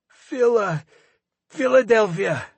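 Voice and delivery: breaking high, gasping the name; gasped out in pain. A narrator's reading. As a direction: force it out through the pain. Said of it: "Levkin gasped out".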